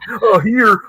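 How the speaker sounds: high, loud, awkward offer; starstruck fluster. Retold or imitated. Fan handing his flyer over for signing, flustered. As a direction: blurt it out with the voice jumping up.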